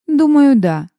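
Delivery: high first word dropping into ease; lazy smile. A narrator's reading. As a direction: answer through a lazy, teasing smile. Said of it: "с ленивой улыбкой ответила Лиззи". (с ленивой улыбкой)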